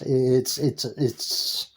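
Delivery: real stammer, stuck on one word; stuttering. Real, not acted. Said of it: "I know I'm stuttering".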